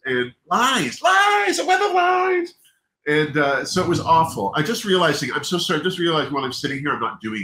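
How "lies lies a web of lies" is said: hammed-up shout, much higher; mock dramatic outcry. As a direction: cry out theatrically about the lies. Mock dramatic exclaiming about lies.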